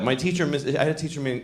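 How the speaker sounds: normal talking voice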